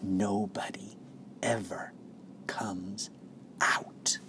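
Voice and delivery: very soft, spaced-out hushed words; secret whisper. A narrator's reading. Grandfather sharing a secret with Charlie, soft whisper. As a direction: whisper it word by word, with pauses. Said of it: "lowered his voice to a soft, secret whisper".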